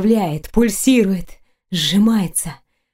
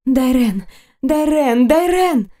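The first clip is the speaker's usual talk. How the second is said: name called higher each time; ecstatic cry; 4 semitones higher, slower than usual. Acted. Heroine overwhelmed, calling her lover's name, rising cries. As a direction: climb in pitch with every repeat.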